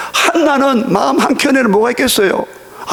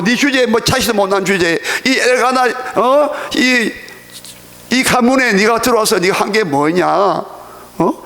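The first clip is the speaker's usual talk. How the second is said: mock her from above, voice pitched up and scornful; rival wife taunting; 2 semitones higher than usual. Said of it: "음성 묘사해 볼게요".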